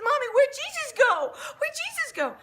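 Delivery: put-on high, loud child's voice, half laughing; little girl's plea. Daughter asking mommy for baby Jesus, high child's plea. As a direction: plead like a small upset girl. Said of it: "And she says".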